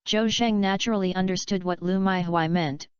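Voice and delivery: ordinary delivery, nothing marked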